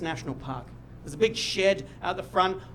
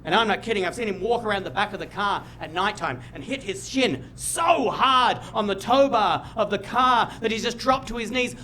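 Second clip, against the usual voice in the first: ordinary talk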